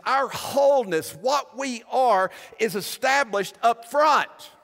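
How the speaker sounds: preaching at full force. Acted. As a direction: proclaim it over the congregation. Sermon climax on grace.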